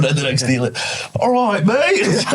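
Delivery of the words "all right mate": high, drawn-out mock Welsh accent; badly faked Welsh, pitched way up; attempted Welsh accent. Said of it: "I could do a Welsh accent".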